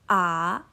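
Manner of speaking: rising "a"; "a" climbing like a question; second tone. Shown as a demonstration. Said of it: "nada dua itu kita baca naik".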